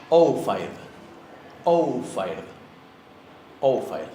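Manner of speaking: "Oufire" opening on a drawn "ou"; long diphthong O. Shown as a demonstration. Teacher giving an example word with the Icelandic diphthong "Ou" in "Oufire". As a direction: stretch the "Ou" of "Oufire", falling after it. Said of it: "la U final es más larga".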